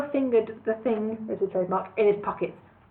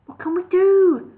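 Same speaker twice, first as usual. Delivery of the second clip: desperate cry; 9 semitones higher, 7 dB louder than usual. A narrator's reading.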